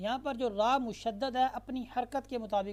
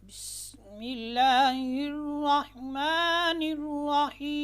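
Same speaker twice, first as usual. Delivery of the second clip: slow, melodic chanted recitation, higher and louder; Quranic recitation; 5 semitones higher, 6 dB louder, much slower than usual. Shown as a demonstration. Teacher opens the lesson with slow melodic recitation. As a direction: recite it slowly with drawn-out tones.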